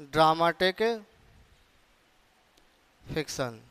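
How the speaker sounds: long pauses after each word of "in dramatic और non dramatic fiction"; word-by-word dictation. Shown as a demonstration. Phrase repeated for writing down.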